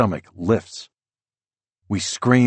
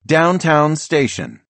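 high, slow, louder announcement; automated attendant voice; 8 semitones higher, 5 dB louder, slower than usual. A narrator's reading. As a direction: announce the stop like a recorded voice. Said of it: "The attendant announces".

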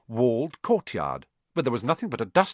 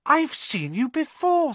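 put-on young Harry.

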